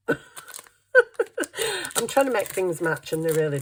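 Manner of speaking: laughing through the words; amused, laughing it out. Real, not acted. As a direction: giggle while you complain. Laughing at mismatched stickers.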